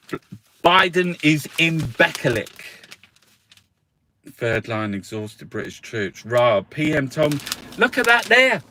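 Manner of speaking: normal talking voice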